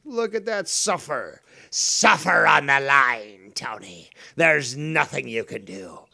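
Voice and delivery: loud, hammed-up villain savouring revenge; gloating villain. Angler taunting the hooked Tony fish, gloating villain. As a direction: relish the victim's struggle, punching key words.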